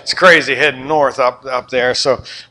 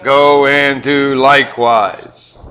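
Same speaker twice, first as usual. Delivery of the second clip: slow, louder, each word weighted; emphatic group reading; 5 dB louder, much slower than usual. Shown as a demonstration. Pastor leading the congregation in reading. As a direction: read it out slowly with force. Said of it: "read it with me".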